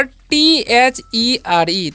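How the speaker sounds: very slow, letter by letter; spelling out letters. Shown as a demonstration. Teacher spelling a word for beginners, very slowly. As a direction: spell T-H-E-R-E out with long gaps between letters. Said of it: "इसे there नहीं पढ़ना है".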